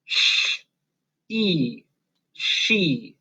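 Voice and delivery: hushing "sh", long ee for "e", then blended "she"; sounding out. Shown as a demonstration. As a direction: sound out "sh, e, she" with a pause between parts. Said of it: "and say".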